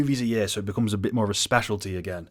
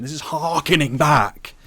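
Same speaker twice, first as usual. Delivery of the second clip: slow, louder mock-pompous line; mocking drawl; 6 semitones higher, 7 dB louder, slower than usual. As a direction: draw it out to mock the cliché. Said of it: "The phrase harkening back".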